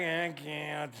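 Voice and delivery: low, soft, defeated mutter; deflated kid. Retold or imitated. Kid picked last, quietly giving up. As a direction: sink into being picked last. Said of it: "then you're like".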